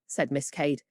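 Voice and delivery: plain reading voice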